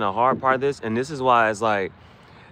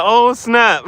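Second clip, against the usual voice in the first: excited; 12 semitones higher, 9 dB louder than usual. Real, not acted. Reacts to the gift.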